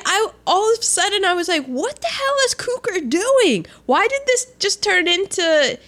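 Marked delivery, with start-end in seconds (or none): 1.7-5.8 much higher, exclaimed disbelief; retold bafflement. Recalling the sudden still photographs, baffled outburst. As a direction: replay your baffled outburst, pitched way up. Retelling baffled reaction to the film.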